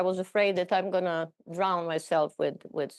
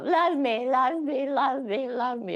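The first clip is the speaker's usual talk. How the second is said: put-on cranky child; 7 semitones higher than usual. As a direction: play a needy cranky child pleading. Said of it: "this cranky child is craving for love".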